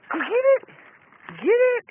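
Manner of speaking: high squeaky get it; squeaky praising a dog. Real, not acted. Urging dog to fetch, high voice.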